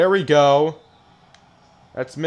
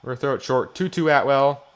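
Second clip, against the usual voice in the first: normal talking voice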